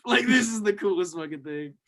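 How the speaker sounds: normal talking voice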